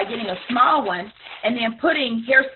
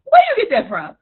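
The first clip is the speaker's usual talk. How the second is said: skeptical follower; 9 semitones higher, 4 dB louder than usual. Retold or imitated. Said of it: "be like".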